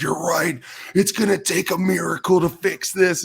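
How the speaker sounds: high, loud mock meltdown; losing his mind. Player echoing a coach who's losing it, mock hysterics. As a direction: blow it up into a hammed-up freak-out. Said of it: "just losing his mind".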